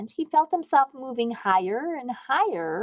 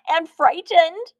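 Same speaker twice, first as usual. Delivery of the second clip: usual speaking voice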